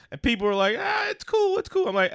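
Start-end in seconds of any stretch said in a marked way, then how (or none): 0.8-1.9 much higher, breezy brush-off; dismissive friends. Retold or imitated. Friends on the raft brushing off his worry, high careless mock voice. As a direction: toss it off like nothing matters.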